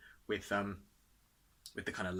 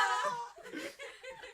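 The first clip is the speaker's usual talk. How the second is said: burst of laughter. Real, not acted. Friends cracking up after the meme chant, big laugh.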